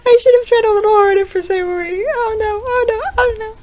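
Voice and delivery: high, near-tearful lament; distraught. Real, not acted. Player regretting choices for Sayori, tearful lament. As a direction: mourn it out loud.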